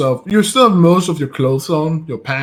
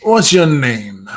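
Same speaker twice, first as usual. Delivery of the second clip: slow, drawn-out question; deliberate repeated demand; slower than usual. Acted. Captor asking the mumbling prisoner's name, slow and deliberate. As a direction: spell out the demand slowly.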